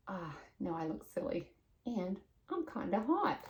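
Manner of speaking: soft, let-down mumble; deflated and quiet. Acted. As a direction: sigh it out, disappointed. Pete voiced, deflated.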